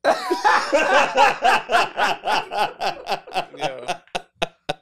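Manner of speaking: drawn-out cackling laugh; long helpless laugh. Real, not acted. Host loses it looking at the puppet, laughing helplessly. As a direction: crack up completely.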